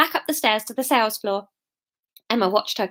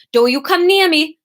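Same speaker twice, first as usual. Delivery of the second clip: loud, higher warning in shaky-attempt Welsh; attempted Welsh accent; 5 semitones higher, 5 dB louder than usual. Shown as a demonstration. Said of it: "can't get back into Welsh".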